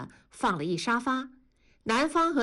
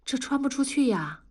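tentative, higher-pitched question; 小心翼翼; 6 semitones higher than usual. A narrator's reading. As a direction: ask it a little uncertainly. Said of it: "小心翼翼地问". (小心翼翼地试探着问)

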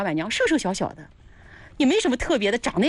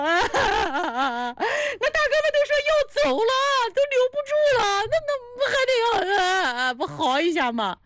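mock howling; 13 semitones higher than usual. Streamer justifying a howl over departing big brothers, mock howling. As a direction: howl it in pretend grief, breaking into sobs.

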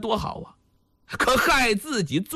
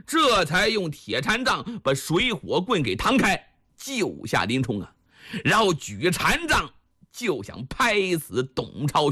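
usual speaking voice

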